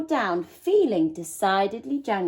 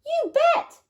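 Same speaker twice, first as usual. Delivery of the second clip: yell; 4 dB louder than usual. A narrator's reading. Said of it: "yelled Lester".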